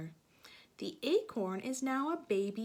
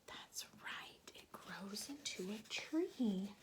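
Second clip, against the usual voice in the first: soft unvoiced whisper; 7 dB quieter than usual. Real, not acted. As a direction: hush it like a secret. Answers child softly.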